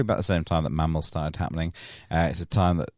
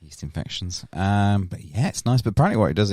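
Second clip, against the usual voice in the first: usual speaking voice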